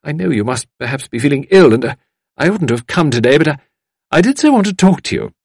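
high, earnest fussy lady voice; apologetic visitor. A narrator's reading. Visitor explaining why she came today, apologetically pleading. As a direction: plead with fluttery eagerness.

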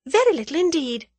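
high-pitched, composed reply; cool young heroine. A narrator's reading. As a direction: answer the crook lightly in a high voice. Heroine coolly answers the crook.

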